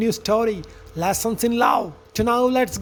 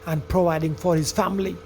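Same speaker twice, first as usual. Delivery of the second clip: lower, measured storyteller voice; story narration; 6 semitones lower, 4 dB quieter than usual. A narrator's reading. Narrator telling friends of farmer Jonathan and son Joe, story narration. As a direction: narrate it like a fable, evenly.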